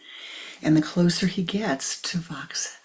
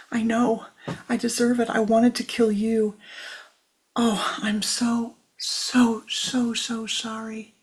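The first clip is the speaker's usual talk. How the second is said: remorseful cry; 6 semitones higher, 5 dB louder than usual. A narrator's reading. Vish remorseful, just been sobbing.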